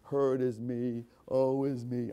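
slow, mocking woe-is-me lament; mock self-pity moan. Preacher mocks a brooding believer, slow mock lament. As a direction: drag each word out like a self-pitying complainer. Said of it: "Just ruminate about it".

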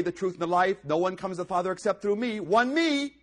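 loud reading, each me jumping high; loud scripture reading. Acted. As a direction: proclaim each verse, flag every me.

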